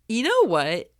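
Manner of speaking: ordinary delivery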